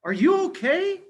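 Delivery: high, squeaky mouse voice; be a tiny worried mouse; Mama Mouse asking the lion if he is okay; small mouse voice. Acted. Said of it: "She says".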